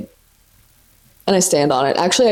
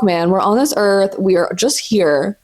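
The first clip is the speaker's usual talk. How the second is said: ordinary talk, nothing marked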